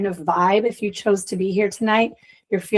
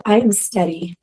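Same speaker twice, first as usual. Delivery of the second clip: measured meditation recitation, each phrase left to settle; guided affirmation. Acted. Leader saying affirmations aloud for the group to repeat, guided affirmation.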